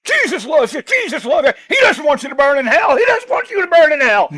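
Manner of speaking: high, shouted manic street-preacher rant; crazy man act. Retold or imitated. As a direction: scream salvation at them like a madman. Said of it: "It's called Crazy Man Act".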